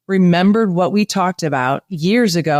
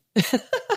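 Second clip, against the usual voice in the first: laugh; 7 semitones higher, 6 dB quieter than usual. Real, not acted. Laughs after her surf story.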